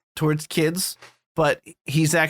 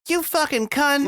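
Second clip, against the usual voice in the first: high, slow put-on girl's insult; hammed-up girl swearing at mom; high mock child voice, drawn out; bratty girl voice; 11 semitones higher than usual. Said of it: "you hear her go".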